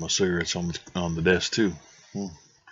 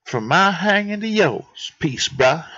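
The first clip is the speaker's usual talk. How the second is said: high squirrel sign-off; squirrel character; 7 semitones higher than usual. Acted. Squirrel bids the detective goodbye, high voice. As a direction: say goodbye in a high laid-back-bro voice.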